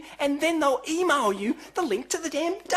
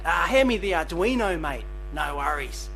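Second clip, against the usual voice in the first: Australian accent; 8 semitones lower than usual. Shown as a demonstration. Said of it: "I'm Australian".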